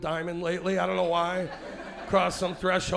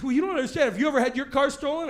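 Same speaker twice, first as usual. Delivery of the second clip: higher, louder, fast indignant complaint; indignant woman; 8 semitones higher, 5 dB louder, faster than usual. Retold or imitated. Woman on the curb challenges the comic, higher indignant whine. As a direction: push back at him, offended. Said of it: "She said".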